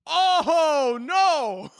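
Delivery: high, loud hammed-up oh no; mock horror. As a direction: act appalled in a high loud cry. Mock horror at new conspiracies.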